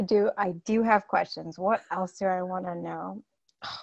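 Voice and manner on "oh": unvoiced muttered sigh; whispered aside. Real, not acted. Coach collecting herself before questions, a whispered aside. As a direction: whisper it to yourself, flustered.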